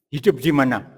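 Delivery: normal talking voice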